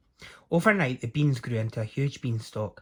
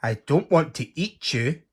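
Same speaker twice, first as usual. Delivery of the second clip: gasping giant; 4 semitones higher, 4 dB louder than usual. Acted. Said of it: "gasped the giant".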